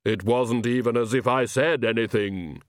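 lightly voiced otherworldly figure; a hint of an altered, unearthly voice; Death. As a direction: speak as a mildly put-out spectre. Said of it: "Death shook his head".

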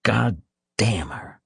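slow, understated angry curse; cursing aloud. A narrator's reading. Hospital patient cursing his wife aloud, slow contained anger. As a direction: curse her slowly, spacing out the words. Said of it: "He said aloud".

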